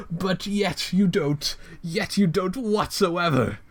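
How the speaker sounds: high, theatrical villain rant; Joker. Acted. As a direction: go full cringe villain, mocking and unhinged. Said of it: "full Joker".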